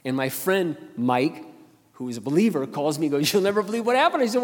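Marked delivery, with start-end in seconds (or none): 3.3-4.2 excited news. Retold or imitated. Friend excitedly calling.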